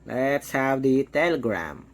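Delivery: usual speaking voice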